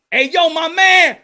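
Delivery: loud, high, drawn-out hail; re-enacted challenge shout; calling out. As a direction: shout to stop a man in the street. Retold calling out to stranger.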